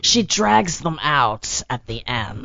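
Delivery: slow drawl, words dragged out; slow right down and stretch the word endings; Whoopi Goldberg. Acted. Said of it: "talks this slow".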